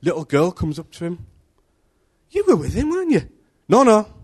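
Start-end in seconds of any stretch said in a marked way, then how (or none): 2.3-3.3 put-on high questioning girl voice; girl accusing. 3.7-4.1 high, loud denial; hasty denial. Acted. Peter denies.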